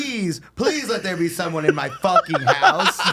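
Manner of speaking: slow, high plea through laughter; beg, cracking up as you go; laughing plea. Pretend. Homeowner begging for a burglar, laughing.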